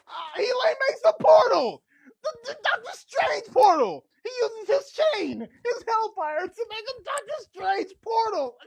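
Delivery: loud, high, teary excitement; excited shouting. Real, not acted. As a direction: yell it in disbelief and joy. Thrilled by the twist.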